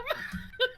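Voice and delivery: high-pitched giggle; high laugh. Real, not acted. Laughing at husband story.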